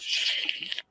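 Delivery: hushed unvoiced hiss; mock frantic whisper; urgent whisper. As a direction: hush her and pull her in. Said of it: "He goes".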